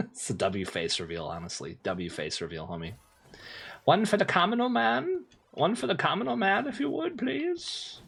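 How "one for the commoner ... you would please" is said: high, put-on begging peasant. Streamer begging chat as a peasant, high and pleading.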